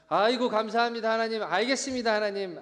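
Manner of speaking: effusive thanks. Acted. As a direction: act out an overeager, grateful yes to God. Acting a meekly thankful Abraham.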